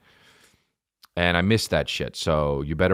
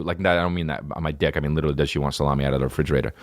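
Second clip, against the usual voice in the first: ordinary talk, nothing marked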